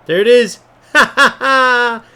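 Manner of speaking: loud, high burst of gloating laughter; triumphant laugh. Real, not acted. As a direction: crow over the goal with a big laugh. Goal scored against cheating rival.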